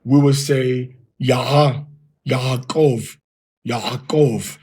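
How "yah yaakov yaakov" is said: slow "Yah, Yaakov" with a breathy h between the vowels; careful academic Hebrew pronunciation; Tiberian Hebrew. Shown as a demonstration. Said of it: "in the Tiberian system now, we would say".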